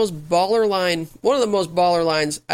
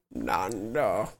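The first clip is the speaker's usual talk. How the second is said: low, eerie creature voice; creepy curse voice; 11 semitones lower, 8 dB quieter than usual. Retold or imitated. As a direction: growl it like a curse spirit. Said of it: "in his creepy curse voice".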